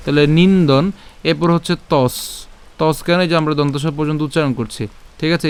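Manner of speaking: usual speaking voice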